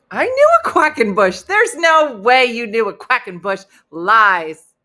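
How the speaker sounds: loud, high incredulous outburst; mock outrage. Host disputing a viewer's claim about the name, mock-outraged shouting.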